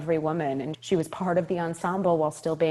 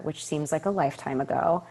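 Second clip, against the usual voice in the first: ordinary talk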